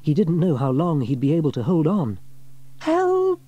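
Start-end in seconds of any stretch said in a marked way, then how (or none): 2.8-3.4 loud high call for help; crying for help. A narrator's reading. James clinging to a branch, crying for help. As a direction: shout it across the water. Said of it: "he cried".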